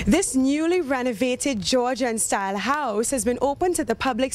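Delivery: bright, energetic TV presenter read; news reporter. Acted. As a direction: deliver it like a travel-show host. Reporter's upbeat stand-up.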